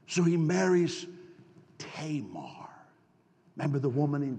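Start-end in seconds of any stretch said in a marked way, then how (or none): 1.8-2.7 whispered name. Real, not acted. Dramatic reveal of name.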